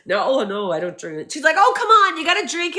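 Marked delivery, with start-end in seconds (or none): 0.1-1.3 mildly awkward, trailing-off refusal; imitated awkwardness. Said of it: "he's all awkward". 1.6-2.8 high-pitched mock peer pressure; playful coaxing. Acts out her coaxing the reporter.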